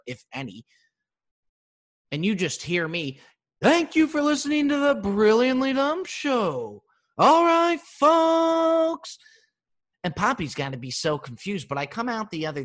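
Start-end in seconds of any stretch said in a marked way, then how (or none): 3.6-9.2 loud, high announcer sign-off; podcast sign-off. Retold or imitated. Comic shouting his show outro to listeners. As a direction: boom it out like a show host.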